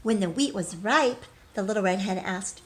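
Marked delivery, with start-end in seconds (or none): none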